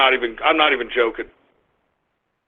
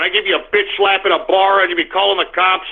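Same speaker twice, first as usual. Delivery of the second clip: ordinary delivery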